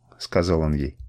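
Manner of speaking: ordinary delivery, nothing marked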